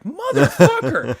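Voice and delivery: high, loud, slow drawn-out curse through a laugh; amazed DM curse. Retold or imitated. DM reacting to a natural twenty, laughing amazed. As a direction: stretch it out, laughing in disbelief. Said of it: "And he's like".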